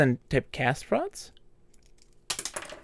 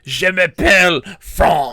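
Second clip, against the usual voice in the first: slow, louder mock French accent; 3 semitones higher, 7 dB louder than usual. Player still mocking French, slow mock French accent. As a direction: roll out the fake French accent. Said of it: "you're like".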